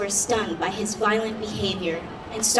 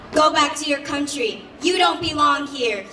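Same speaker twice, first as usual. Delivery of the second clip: higher, louder angry outburst; hostile shout; 5 semitones higher, 4 dB louder than usual. A narrator's reading. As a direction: shout at a stranger to leave. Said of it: "he shouted".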